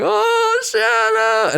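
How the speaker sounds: very high, teary cry of a name; mimicking crying kids. Retelling the film's kids, high weepy call. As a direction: sob the name out like a child. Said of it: "the kids are like".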